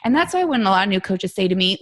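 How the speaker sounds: usual speaking voice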